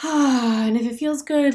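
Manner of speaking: usual speaking voice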